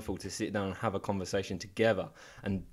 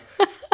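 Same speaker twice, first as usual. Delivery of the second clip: nervous laugh; 9 dB louder than usual. Retold or imitated. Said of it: "you're like".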